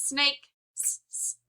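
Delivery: s hissed long like a snake; drawn-out s like a snake. Shown as a demonstration. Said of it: "the sound that sounds like a s".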